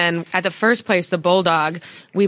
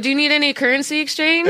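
deflecting fake question; 8 semitones higher, faster than usual. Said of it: "I was like".

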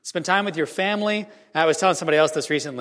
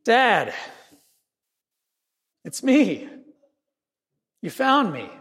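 high call from below, relieved; a child's higher cry of relief; son yelling back; 5 semitones higher, slower than usual. Retold or imitated. Trapped son calls up to his father, higher relieved call. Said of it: "yell back from that little hole".

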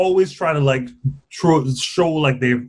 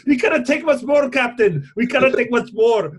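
higher, hammed-up Scottish plea; mock Scottish engineer near his limit; bad Scottish accent; 7 semitones higher than usual. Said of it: "Your, your Scottish sounds Jamaican".

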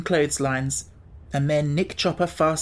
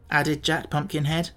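plain reading voice

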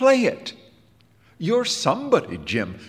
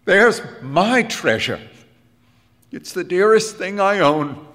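emotional; 8 semitones higher, 4 dB louder than usual. A narrator's reading. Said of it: "puffing with emotion".

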